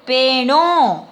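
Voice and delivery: "Pero" loud, final O stressed through the nose; nasal accent. Shown as a demonstration. Teacher models the word for bald, nasal accent. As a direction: punch a nasal last O in "Pero". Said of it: "tiene acento nasal".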